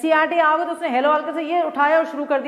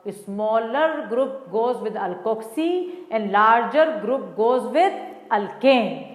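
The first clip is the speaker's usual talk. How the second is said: drawn-out rule spoken word by word for students to write; very slow dictation; 3 semitones lower, much slower than usual. Shown as a demonstration. Teacher slowly dictating the memory rule to her class. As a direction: lay each word down slowly so they can copy it. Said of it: "teacher states rule for memorizing".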